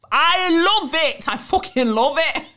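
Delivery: high, loud, drawn-out glee; thrilled, laughing outburst; real delight. Real, not acted. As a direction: gush loudly, breaking into laughter. Delighted by the listener's insult.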